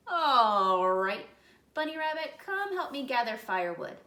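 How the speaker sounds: loud, high gruff critter voice; gruff varmint. Acted. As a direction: bark it out like a grumpy scruffy beast. Varmint orders Buddy.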